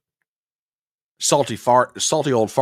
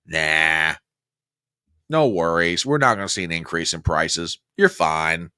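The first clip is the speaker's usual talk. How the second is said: lower, dismissive mock Democrat; 9 semitones lower, 3 dB quieter than usual. Host mocking Democrats shrugging off price worries, lower mock voice. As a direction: brush it off like a smug Democrat. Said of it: "what do the Democrats say?".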